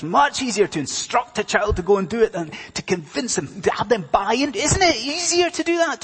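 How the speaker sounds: high, loud, pressing sermon delivery; impassioned preaching. Real, not acted. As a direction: preach with rising force, questions pitched high. Preaches at full force.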